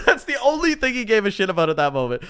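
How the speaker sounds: high, amused burst through laughter; laughing. Real, not acted. Amused by Happy wanting only money, laughing. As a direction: crack up while saying it.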